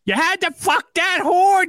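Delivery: high, drawn-out screeching yell; Melania yelling. Acted. Wife yelling at her arrested husband, mock furious yell. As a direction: scream it slowly as an outraged wife. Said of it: "Here's Melania yelling".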